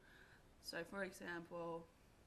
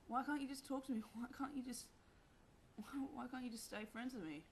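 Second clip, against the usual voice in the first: hurt plea; 7 semitones higher than usual. Retold or imitated. Pleading with the boys.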